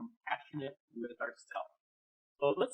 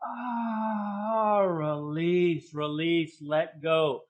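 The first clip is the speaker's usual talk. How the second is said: louder, rising coaching through the stretch, then a sighing release; guided breathing coaching; 3 semitones higher, 6 dB louder than usual. Acted. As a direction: push them up and back, then let it all go. Leads a breathing exercise.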